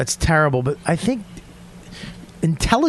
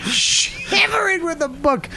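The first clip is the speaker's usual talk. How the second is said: high, slow mocking whine; 12 semitones higher, slower than usual. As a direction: drag it out to tease him. Mocking the bookish guy.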